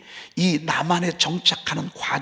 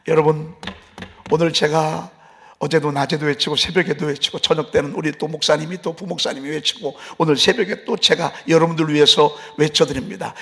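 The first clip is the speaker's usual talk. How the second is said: ordinary talk, nothing marked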